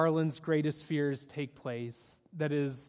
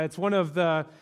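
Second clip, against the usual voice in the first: ordinary talk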